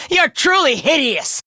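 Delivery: laugh, then taunt; gleeful scorn led by a laugh; mocking laugh. Fighter taunting a hideous enemy, mocking laughter.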